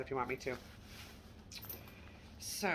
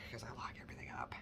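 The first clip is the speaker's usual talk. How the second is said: whispered aside; 7 semitones lower, 15 dB quieter, much faster than usual. Real, not acted.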